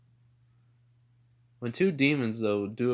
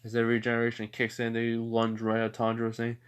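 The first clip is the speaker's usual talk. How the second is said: ordinary delivery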